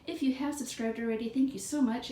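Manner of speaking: ordinary delivery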